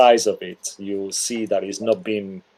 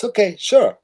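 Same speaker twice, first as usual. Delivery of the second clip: eager husband; 11 semitones higher than usual. Acted. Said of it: "And he says".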